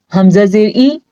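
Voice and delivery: normal talking voice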